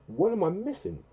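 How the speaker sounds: high puzzled question; mock puzzled. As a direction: act out not getting it. Retold confrontation.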